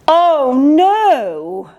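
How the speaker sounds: wail. Acted. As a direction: wail it out like a hurt pup. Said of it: "wailed Winston".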